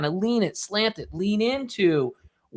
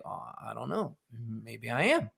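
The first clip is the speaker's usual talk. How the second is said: musing reader; 6 semitones lower, 10 dB quieter than usual. Retold or imitated. Reader musing over the question ad.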